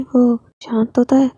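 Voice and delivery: ordinary delivery, nothing marked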